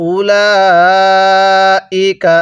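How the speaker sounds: উলাইকা with a very long four-alif stretch; Quranic recitation. Shown as a demonstration. Teacher demonstrating four-alif elongation, slow recitation. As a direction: hold the vowel very long and slow. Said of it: "চার আলিফ টান দিব".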